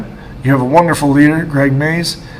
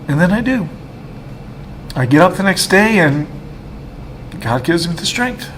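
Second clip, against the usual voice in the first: emotional; 4 semitones higher, 3 dB quieter than usual. Real, not acted.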